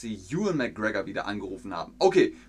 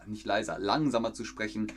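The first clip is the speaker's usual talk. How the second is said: ordinary delivery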